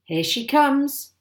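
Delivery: high, loud, slow announcement; drawn-out excited call. A narrator's reading. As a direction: call it out, stretching the last word. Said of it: "my father called out".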